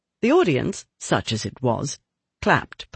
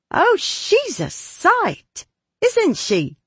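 much higher, slow, delighted gasp; excited young girl; much slower than usual. Acted. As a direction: marvel at it, high and thrilled. Diana delighted by cat woman.

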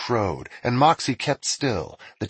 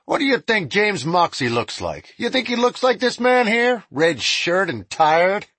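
mocking, raised lawman voice; deputy voice; 10 semitones higher, 4 dB louder than usual. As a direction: tease the prisoner loudly. Deputy character voice.

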